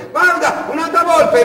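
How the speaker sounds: very high, slow delighted cry; a tourist wife spotting a fox in the desert; excited wife. Acted. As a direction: point it out like a thrilled tourist. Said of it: "E la moglie".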